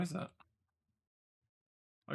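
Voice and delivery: usual speaking voice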